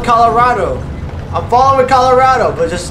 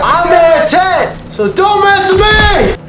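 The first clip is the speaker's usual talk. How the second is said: tank taunt; 7 semitones higher, slower than usual. Real, not acted. Gamer taunting enemies from tank.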